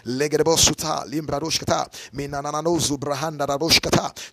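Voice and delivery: rapid run of prayer syllables; praying in tongues. Shown as a demonstration. Preacher showing the congregation how to pray briefly, praying in tongues. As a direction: demonstrate praying in tongues, rolling straight on. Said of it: "just pray ten minutes, five minutes".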